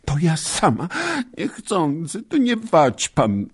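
high, tearful cry; a girl fighting back tears after losing her sabre; tearful protest. Acted. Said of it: "wolała ze łzami w głosie".

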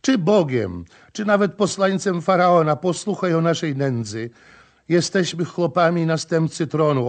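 lamenting plea. Acted. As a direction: beg the prince to hear her. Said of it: "jęczała". (żałosne błaganie żony)

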